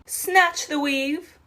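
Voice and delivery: high, slow, sassy drag flourish. Acted. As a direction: deliver it like a diva reveal. Dramatic wig removal.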